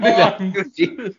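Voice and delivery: high-pitched laughing. Real, not acted. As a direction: laugh while correcting him. Laughs at four-word answer.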